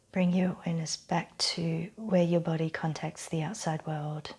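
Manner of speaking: calm meditation voice, long silences between phrases; unhurried, settling relaxation voice; guided breathing exercise. Real, not acted. Leads breathing and ends exercise.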